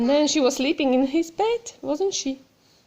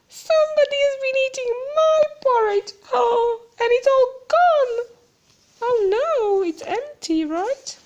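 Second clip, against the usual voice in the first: put-on much higher, fast, weepy baby bear voice; a tiny bear close to tears; high, teary, hurried little bear; crying Baby Bear; 11 semitones higher than usual. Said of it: "he was crying".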